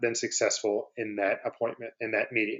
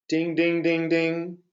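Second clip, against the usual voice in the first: higher, evenly pitched ding-ding; four ringing dings on one held note; imitated alert bell; 5 semitones higher, much slower than usual. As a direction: chime it like a bell going off. Mimics a mental alert bell.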